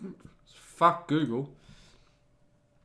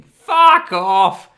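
outraged shout; 6 semitones higher, 12 dB louder than usual. Real, not acted. Reacting angrily to Wikipedia text.

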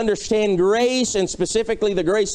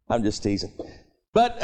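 ordinary talk

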